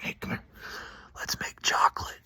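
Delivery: conspiratorial mock-secret whisper. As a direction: whisper it into his ear. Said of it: "I whispered in a grown man's ear".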